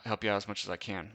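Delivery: ordinary talk, nothing marked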